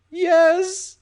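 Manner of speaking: high panicked yes; hysterical. A narrator's reading. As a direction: blurt it out hysterically. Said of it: "nodded hysterically".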